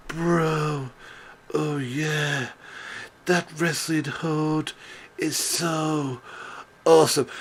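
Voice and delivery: lightly voiced dopey stoner drawl, slow; laid-back surfer dude, stretched out; mock Matt Riddle. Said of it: "he's literally".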